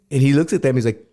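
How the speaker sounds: usual speaking voice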